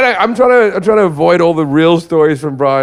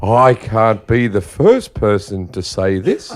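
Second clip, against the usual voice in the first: imitating mum; 6 semitones lower, slower than usual. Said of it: "she goes".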